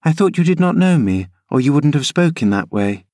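put-on a girl urging her nurse uphill; eager young Cathy. Cathy offended.

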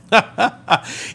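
short, high chuckle; laughing. Real, not acted. Preacher amused by the unconquerable mountain idea, short laugh. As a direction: let out a quick ha-ha-ha.

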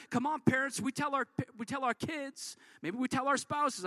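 ordinary talk

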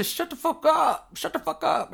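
high mock yell of the crowd; mocking others. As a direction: shout it like annoyed people. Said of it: "They say".

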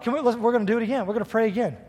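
very fast, pressing on; rushed retelling. Retold or imitated. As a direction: rattle it off impatiently. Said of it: "So I'm like".

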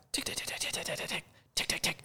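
soft unvoiced tick tick imitation; whispered clicks. Shooter imitating turret adjustments, whispered. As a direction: click it out under your breath. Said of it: "imitating scope clicks".

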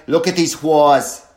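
mock East Coast accent, loud and slow; extreme East Coast accent. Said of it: "a really extreme Eastern Coast accent".

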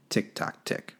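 clock sound breathed out; hushed clock imitation; whispered tick-tock.

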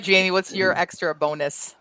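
ordinary talk

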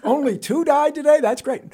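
high, loud impressed exclamation; hammed-up applause for grim news; mock delighted reaction. Reporters applauding the transit spokesman, mock delight.

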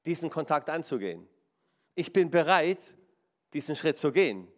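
ordinary talk

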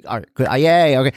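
dad giving up and cheering his dancing son. Retold or imitated. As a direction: cave in and cheer along. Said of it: "I'm like".

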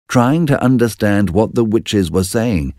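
ordinary delivery, nothing marked

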